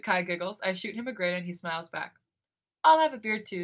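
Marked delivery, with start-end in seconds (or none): 2.8-3.6 a little louder, chiming in; quick raised-voice interjection; piping up. A narrator's reading. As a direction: pipe up to join the order. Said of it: "Subin pipes up".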